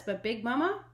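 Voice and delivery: ordinary delivery, nothing marked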